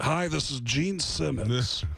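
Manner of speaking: slow, self-important rock-star introduction. Retold or imitated. Comic phones manager's office as rock star, grand. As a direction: announce yourself grandly, word by word. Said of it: "I said".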